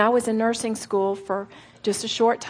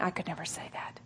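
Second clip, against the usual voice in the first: whisper; 5 semitones lower, 13 dB quieter than usual. Retold or imitated. Quoting doubtful listeners.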